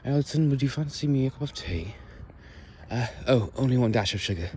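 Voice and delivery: high Victorian-style British tea-time voice; posh British accent. Shown as a demonstration. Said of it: "very posh British accent".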